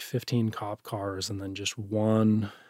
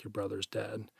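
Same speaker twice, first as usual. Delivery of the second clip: hushed and much softer, still voiced; retold whisper; 14 dB quieter than usual. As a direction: quote the news very softly, almost under the breath. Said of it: "you know, whispered".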